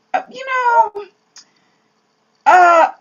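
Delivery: high, hesitant stalling; reluctant hedging. Pretend. Preacher imagining her answer to God, hesitant stalling. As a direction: squirm out of God's order.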